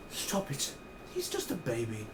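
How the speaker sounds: whispered motherly plea; overprotective whisper. Retold or imitated. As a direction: hush him protectively. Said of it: "Nina's being that overprotective".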